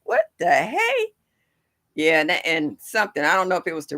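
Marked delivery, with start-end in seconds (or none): 0.0-1.1 high, slow startled squeak; a squeaky what-the-hey at the porch; surprised. Retold or imitated. Reacting to a baby raccoon on the banister, high and startled.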